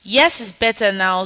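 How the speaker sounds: normal talking voice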